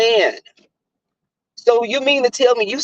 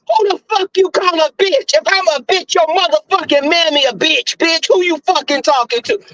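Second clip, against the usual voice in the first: retold high-pitched angry outburst; going off on him; 8 semitones higher than usual. Retelling the fight with an ex, going off indignantly. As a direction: fire back indignantly, pitched way up. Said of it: "I went off on him".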